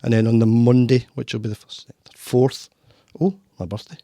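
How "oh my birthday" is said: usual speaking voice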